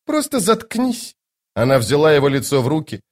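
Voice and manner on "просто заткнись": high, slow command; imperious hush. Acted. Lady Envy cutting off Toc's excuse, imperious. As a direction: silence him slowly in a high voice.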